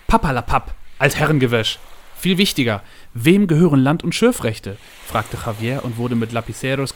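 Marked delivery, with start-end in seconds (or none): 0.1-4.8 loud, emphatic waving-away; brusque dismissal. A narrator's reading. Boss brushes off ghost tale and asks about rights, brusque. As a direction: dismiss it, then demand the important fact.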